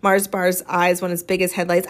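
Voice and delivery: usual speaking voice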